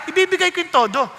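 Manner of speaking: ordinary delivery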